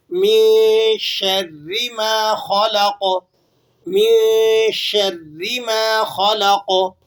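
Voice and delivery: very slow chanted tilawat; Quran recitation. Shown as a demonstration. Teacher recites the full verse again. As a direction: recite slowly for students to follow. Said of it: "پوری آیت مبارکہ کو سن لیجئے".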